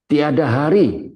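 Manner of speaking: slow, loud, high preacher's slogan; rallying cry. Real, not acted. Preacher ending his message to the congregation, rallying cry. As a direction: proclaim it, stretching each word.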